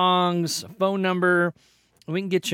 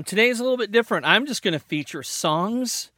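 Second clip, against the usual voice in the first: rapid, higher DJ burst; radio energy; 5 semitones higher than usual. Shown as a demonstration. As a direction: spit it out like a hyped DJ. Radio-style patter.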